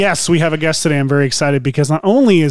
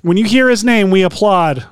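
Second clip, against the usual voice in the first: ordinary delivery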